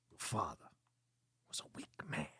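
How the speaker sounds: low "father", then "was a weak man" breathed out in a whisper; a menacing Christopher Walken line, half whispered; Walken impression. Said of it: "like an angry Walken?".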